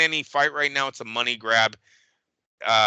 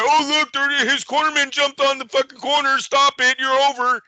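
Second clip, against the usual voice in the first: higher, louder hammed-up ref stopping it; mock referee; 13 semitones higher, 5 dB louder than usual. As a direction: play the ref waving it off. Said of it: "they'll stop the fight".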